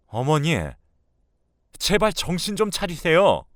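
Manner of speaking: loud, high plea; exasperated son. Acted. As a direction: beg her angrily to see sense. Son pleads angrily.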